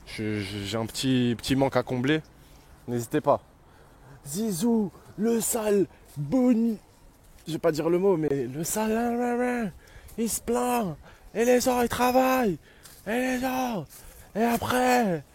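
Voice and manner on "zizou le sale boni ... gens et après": high, slow sneering imitation; mocking whiny voice. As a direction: drawl it out like a petty hater. Mocking a complaining hater.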